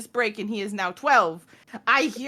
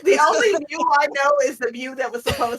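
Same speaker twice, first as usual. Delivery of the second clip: exasperated yell; 4 dB louder, faster than usual. Real, not acted. Loud exasperated rant.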